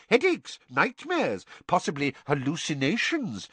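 high, pouncing minister voice; quick Fudge. A narrator's reading. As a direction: seize on it quickly. Fudge listing.

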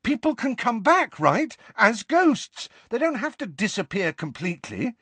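high, eager insistence; urgent. A narrator's reading. Harry needs to hear the dead can return, urgent. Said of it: "said Harry urgently".

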